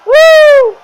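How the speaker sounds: sudden high shouted whoop. Acted. Preacher whoops to stir up the congregation, shouted. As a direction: let out a wild, high whoop.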